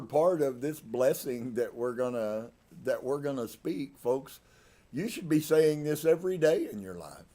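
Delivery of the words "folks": ordinary talk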